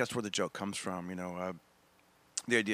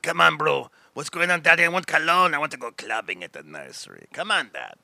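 put-on loud, higher, swaggering toddler bro; Armenian baby man; 3 semitones higher, 9 dB louder than usual. Comic voices his toddler son as a swaggering Armenian baby man. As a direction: push it loud like a tiny club bro. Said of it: "Armenian baby man".

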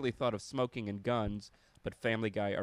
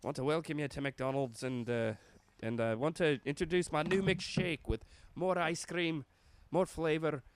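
lilting, higher-pitched mock Scottish pitchman; Scottish Ronald McDonald; 4 semitones higher than usual. Clown pitching his new shake in a commercial, Scottish burr. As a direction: put on a hammed-up Scottish accent. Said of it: "make Ronald McDonald Scottish, go all out".

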